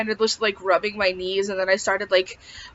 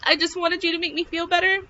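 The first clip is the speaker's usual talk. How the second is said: high-pitched retold tearful plea; retold breakdown; 8 semitones higher than usual. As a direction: imitate losing it, voice climbing high. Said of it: "I lost it".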